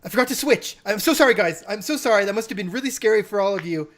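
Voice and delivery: flustered gnome. Acted. Gnome apologizing to the tavern for scaring everyone, flustered. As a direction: babble apologies to the room. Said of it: "And I'm like".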